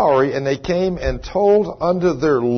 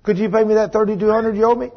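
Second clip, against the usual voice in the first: higher, quick mock-friendly ask; mock-polite request; 6 semitones higher, faster than usual. Creditor casually asking a brother for the money, mock-polite. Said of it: "he just don't go up to him and say".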